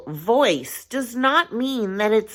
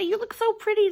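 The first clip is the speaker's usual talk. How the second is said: sweet compliment pitched way up; gushing compliment; 12 semitones higher, faster than usual. Retold or imitated. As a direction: gush admiringly in a high voice. Acting out giving compliments.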